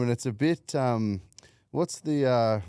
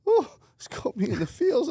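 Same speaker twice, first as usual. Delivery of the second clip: mock emotional squeal; 4 dB louder than usual. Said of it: "and I was like".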